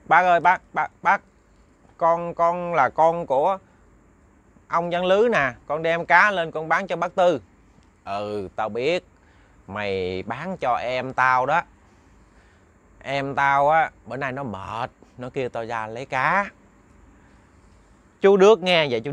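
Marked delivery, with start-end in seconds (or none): 0.1-7.4 put-on higher, louder eager child calling out; a keen boy hailing an elder. Đức calling the old man in the dark, eager and higher. 8.0-16.5 a calm elder taking his time; slow old man. Acted. Old man replies slowly.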